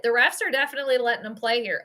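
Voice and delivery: ordinary delivery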